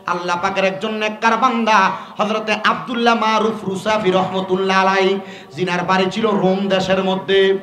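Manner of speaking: ordinary talk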